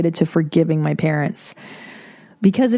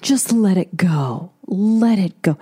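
mock advice voice; slower than usual. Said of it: "it's so easy to say".

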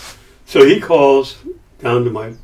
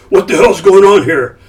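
loud, high, angry demand; shout; 7 semitones higher, 7 dB louder than usual. Retold or imitated. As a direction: bark it like a boss bursting in. Milius bursting in shouting.

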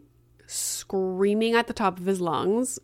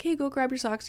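light, higher and softer casual ask; casual request; 5 semitones higher, 5 dB quieter than usual. Retold or imitated. Mom casually sending her son for socks, offhand. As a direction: toss it out softly, pitched up. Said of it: "I just very casually was like".

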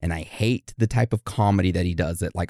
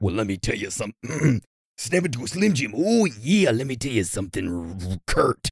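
Randy Savage. Acted. Birthday shout-out to Kurt as a wrestler, hammed-up impression. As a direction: growl it like a wrestling promo. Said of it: "you want a Randy Savage birthday".

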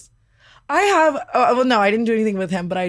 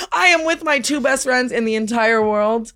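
usual speaking voice

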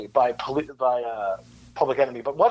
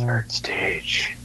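breathy whispered mutter; whispering to himself; 7 semitones lower than usual. Real, not acted. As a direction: say it under your breath, puzzled.

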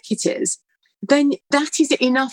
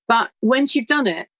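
ordinary delivery

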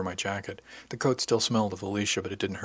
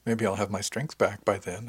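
usual speaking voice

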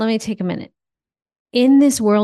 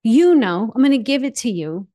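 higher, louder, impassioned declaration; passionate surrender; 5 semitones higher, 3 dB louder than usual. Acted. Declaring surrender to God to her cohost, higher and impassioned. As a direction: throw up your hands and let go.

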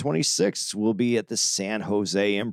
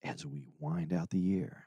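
low, very soft jazz DJ; hushed late-night announcer; radio voice; 6 semitones lower, 10 dB quieter than usual. Pretend. Host winding out the year on air, radio voice. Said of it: "in your radio voice".

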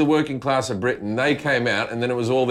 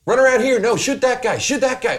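high, excited mock gamer kid; kid gaming online; 10 semitones higher, 4 dB louder than usual. Son yelling to mates in an online game, high excited voice. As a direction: call out orders as a hyped young gamer. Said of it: "he'll be playing computer games".

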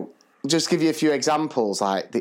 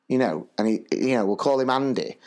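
usual speaking voice

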